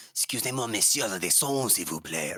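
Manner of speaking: hammed-up French accent; mock French. Shown as a demonstration. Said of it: "speaking a different language".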